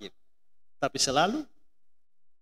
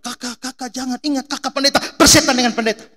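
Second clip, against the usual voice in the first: high, urgent imitated cries, growing louder; retold yelling; 9 semitones higher, 9 dB louder than usual. Mother in the car to her pastor son, urgent yelling. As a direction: call out to stop him, then shout back. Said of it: "mama saya teriak dari dalam mobil".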